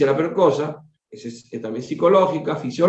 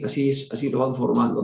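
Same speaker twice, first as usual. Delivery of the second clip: plain reading voice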